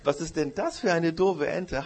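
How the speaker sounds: mocking laugh. Goat mocking the goose's duck drawing, laughing. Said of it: "die Ziege hat sie ausgelacht".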